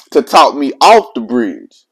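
normal talking voice

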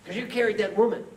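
high, loud mock accusation; acted indignant retort; angry monk. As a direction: snap back in hammed-up outrage. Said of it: "The angry monk says".

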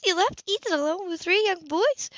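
can't believe he did that; incredulous. A narrator's reading.

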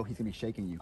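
ordinary delivery

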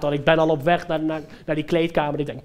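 ordinary delivery

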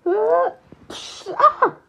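short high, loud yells; battle cry. Acted. Player attacks mid-battle, high battle cries. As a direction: cry out with the launch.

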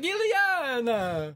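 very high, drawn-out cartoon cry; Colossus. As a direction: wail the name out long and high. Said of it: "whenever Colossus was on".

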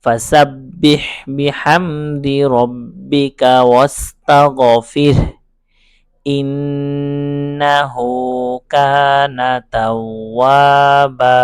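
words flowing together in slow chant; connected, slow Quranic chant; joined recitation. Shown as a demonstration. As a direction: recite the phrase as one smooth melodic line. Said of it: "একসাথে যদি পড়ি".

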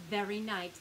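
ordinary delivery

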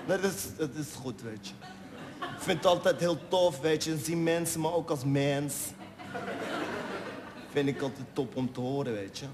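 easygoing Moroccan Dutch street talk; moroccan dutch street slang. Retold or imitated. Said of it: "zei die gozer".

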